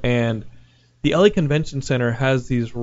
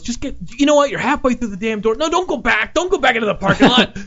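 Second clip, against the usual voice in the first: mock furious worker; 10 semitones higher than usual. Worker ranting at Tommy halfway through the door, very high. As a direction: rant him out of the door. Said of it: "and he goes".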